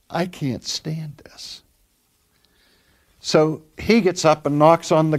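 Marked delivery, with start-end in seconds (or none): 0.1-1.6 a slow, whispered plea, imitating the weeping woman; retold whisper. Preacher voicing a weeping patient to her husband, in a slow whisper. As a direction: breathe it out like someone worn down by pain.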